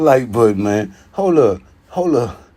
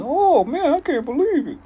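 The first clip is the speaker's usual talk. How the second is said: much higher, gushing fake amazement; mock fan gushing. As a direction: play a starstruck fan gushing. Mock excited fan quote.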